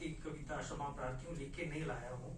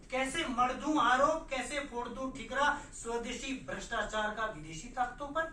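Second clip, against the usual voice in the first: declaimed verse, rising louder and higher on repeats; protest poem recital; 5 semitones higher, 6 dB louder than usual. Acted. Poet questioning faltering freedom before fellow writers, forceful recitation. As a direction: declaim to the gathering, easing on the refrain.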